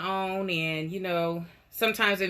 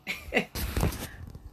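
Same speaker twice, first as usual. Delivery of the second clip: airy laugh; 5 dB quieter than usual. Real, not acted. Reacting to chat.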